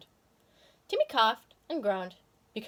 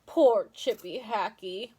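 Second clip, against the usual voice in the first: mock pity; much slower than usual. Narrator's sympathetic aside.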